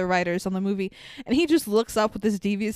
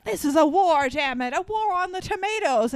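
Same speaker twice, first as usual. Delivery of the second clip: higher, louder hammed-up general's declaration; mock war-room official; 7 semitones higher, 3 dB louder than usual. Government officials declaring war on tomatoes, hammed-up and loud. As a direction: bark it like a blustering general. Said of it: "And they're like".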